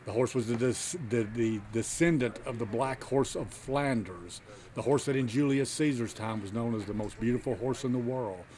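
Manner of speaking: ordinary talk, nothing marked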